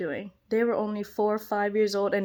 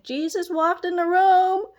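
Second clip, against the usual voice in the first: put-on excited kids' exclamation; 6 semitones higher than usual. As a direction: announce it like wide-eyed kids. Retelling kids' awed realisation.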